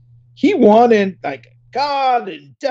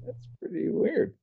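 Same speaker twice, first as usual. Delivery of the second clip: ordinary talk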